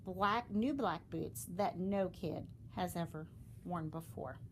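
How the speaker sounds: usual speaking voice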